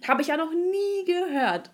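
imitated receptionist, high and drawn out; ungläubige Sprechstundenhilfe. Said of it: "Und dann sie so". (nachgeäffte, gedehnt ungläubige Sprechstundenhilfe)

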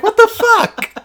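high loud disbelief; shocked outburst. Retold or imitated. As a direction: react in total shock. Player's shocked reaction.

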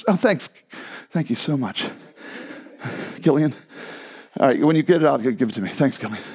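usual speaking voice

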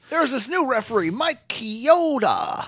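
high, drawn-out announcer imitation; mock announcer introducing a newcomer; mock commentator. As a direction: stretch the name out in a put-on high voice. Mimicking commentary on the referee.

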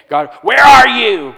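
loud, high booming accusation; condemning God. Retold or imitated. Preacher mimics a condemning God shouting at Adam and Eve. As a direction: boom it like an angry judge. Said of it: "How many of us were taught as little kids".

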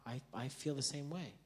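soft, understated emotion; hushed retold confession, close to tears; retold tearful admission. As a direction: admit it quietly to your child. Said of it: "with tears in my eyes".

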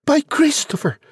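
put-on very high-pitched boy's cry; Jack crying out. A narrator's reading. Jack exclaiming about the giant, high-pitched cry. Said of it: "Jack cried".

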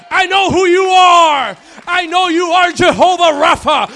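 fiery preacher at the top of his voice; full-force preaching. Acted. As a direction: proclaim it to the whole church.

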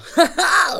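high burst of laughter. Real, not acted. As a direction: let out a squeaky laugh.

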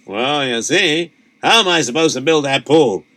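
usual speaking voice

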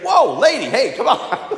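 high, laughing protest; acted-out startled objection; mock protest. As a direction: fend off an insult good-naturedly. Worker fends off woman's insults.

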